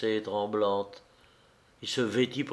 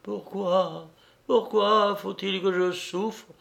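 stammering anguish; 8 semitones higher, slower than usual. A narrator's reading. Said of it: "balbutiait Vassia".

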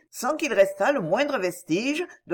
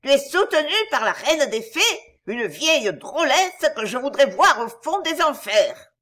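high, loud furious cry; shaking with anger; 6 semitones higher, 4 dB louder than usual. A narrator's reading. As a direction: spit out the curse in rage. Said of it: "ne pouvant contenir sa colère, elle s'écria".

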